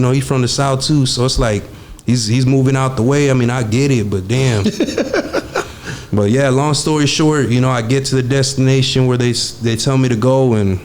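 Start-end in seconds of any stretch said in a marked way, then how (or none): 4.7-6.1 breathy high laugh. Real, not acted. Laughing at his own story.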